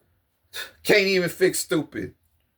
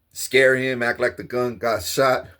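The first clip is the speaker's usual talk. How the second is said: ordinary talk, nothing marked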